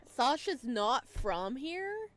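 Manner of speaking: slow, loud, high disbelief; loud, high, very slow amazement; stunned question. Real, not acted. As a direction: draw it out in shock. Shocked realization about Sasha.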